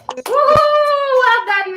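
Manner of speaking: high, loud whoop of celebration; excited cheer. Real, not acted. Host whooping for the weekly winner, excited.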